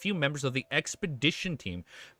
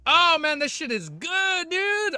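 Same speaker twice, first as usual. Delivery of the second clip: higher and louder, showing off a prize; thrilled higher voice; excited show-off; 14 semitones higher, 6 dB louder than usual. Real, not acted. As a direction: brag about new merch to chat. Showing off Anime Expo merch.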